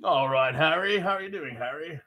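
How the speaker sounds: put-on English accent as Hagrid; playful stab at an English accent; mock English accent. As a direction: greet Harry in an amateur English accent. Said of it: "how's your fucking English accent".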